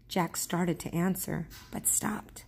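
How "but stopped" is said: hushed narration. A narrator's reading. Narrator hushes for a tense moment.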